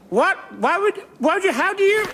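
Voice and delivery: high, startled and flustered; flustered surprise. Acted. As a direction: caught out and spluttering. Caught out, flustered.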